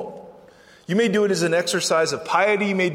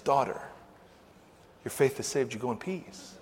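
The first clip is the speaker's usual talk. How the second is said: soft, low, gentle voice; hushed, lowered blessing; tender reassurance; 6 semitones lower, 10 dB quieter than usual. Acted. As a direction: reassure softly, pausing after the first word. Jesus tenderly addresses the fearful woman.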